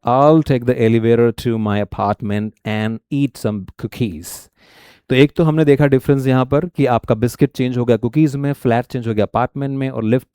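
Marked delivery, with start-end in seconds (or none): 0.1-4.5 slow, careful American English reading; American accent. Shown as a demonstration. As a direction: demo the American version slowly, dropping off at the end. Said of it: "और यहाँ पर क्या होगा".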